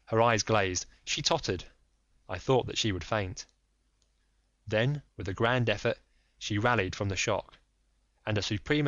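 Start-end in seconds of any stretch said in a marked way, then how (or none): none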